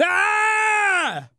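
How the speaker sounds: high, drawn-out scream of horror; imitated scream. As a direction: scream like a startled movie character. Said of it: "the first reaction he has is".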